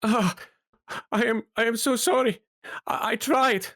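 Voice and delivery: high-pitched, distraught apology; anguished cry. Acted. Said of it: "he screams in anguish".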